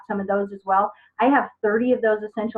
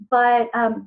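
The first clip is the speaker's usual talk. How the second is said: ordinary talk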